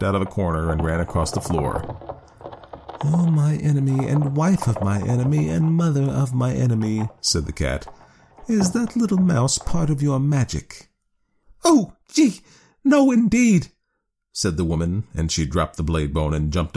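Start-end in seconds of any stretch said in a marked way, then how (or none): 3.0-7.1 snooty bored cat. A narrator's reading. Cat voiced in story. 8.5-10.9 sly cat. A narrator's reading. Cat voiced in story. 11.6-13.7 high, loud panicky squeal; frightened shriek. A narrator's reading. Woman afraid of mouse.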